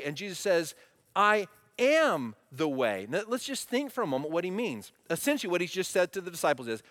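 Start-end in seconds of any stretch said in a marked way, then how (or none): 1.1-3.0 very slow, louder "I am the way" with "am" stressed; solemn emphatic declaration. Shown as a demonstration. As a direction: stretch out "I am the way", hitting "am". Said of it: "the emphasis in this text".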